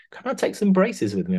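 high, keen imitating voice; mock eager ask. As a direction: pitch it up like an eager kid asking for a treat. Retelling actor asking for braces.

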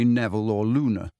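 plain reading voice

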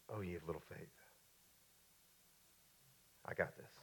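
very soft, low, unhurried; calm understatement. Acted. Jesus to his frightened disciples, very softly and calmly. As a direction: answer the panic with hushed, easy assurance.

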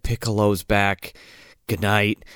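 low deadpan last words; mock dying grandpa.

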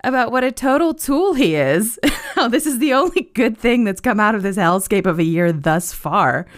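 words riding on laughter; real laughter. Real, not acted. Host recalling what a total tool he is, laughing. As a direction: laugh as you talk, pitch lifted.

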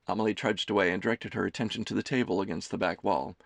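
normal talking voice

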